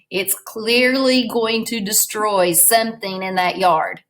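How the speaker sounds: ordinary delivery, nothing marked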